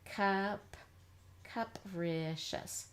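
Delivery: "capricious" slowly as "cup-rih-shus"; "Cup" alone, then "capricious" stretched in chunks; uh sound. Shown as a demonstration. Teacher trying an uh sound, slow and segmented. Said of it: "let's try an uh sound here".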